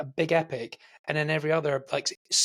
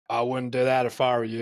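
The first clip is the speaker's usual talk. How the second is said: mock American tough-guy line; imitated Statham American accent; 3 semitones lower than usual. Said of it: "why he did an American accent there".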